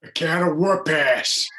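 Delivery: rasped, slow, whispered wrestler growl; gravelly Stone Cold impression. Friend asks for finest accent, rasped wrestler whisper. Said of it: "the finest accent that you could do".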